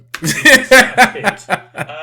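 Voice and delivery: loud, high burst of laughter; real rapid belly laugh; amused laugh. Real, not acted. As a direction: crack up at your own jab. Laughs at guest's look.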